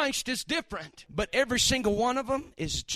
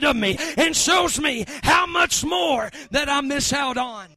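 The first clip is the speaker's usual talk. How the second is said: loud, fired-up preaching; preaching at full force; 3 semitones higher, 7 dB louder than usual. Real, not acted. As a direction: shout it from the pulpit.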